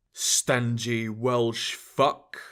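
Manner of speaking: slow, soft Scottish mumble under the breath; mumbled. A narrator's reading. Sweeney mumbling an insult at the cashier, slow soft Scottish mutter. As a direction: mutter it slowly to yourself. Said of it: "Sweeney mumbled".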